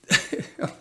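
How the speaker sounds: a short unvoiced chuckle to himself; soft breathy laugh. Real, not acted. Vlogger tells viewers about the news, quiet real chuckle. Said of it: "I'm laughing".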